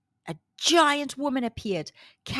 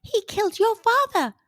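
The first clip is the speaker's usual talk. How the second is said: high fairy voice, louder warning; 10 semitones higher, 5 dB louder than usual. A narrator's reading. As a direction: drop the news like a fairy. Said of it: "warned the fairy".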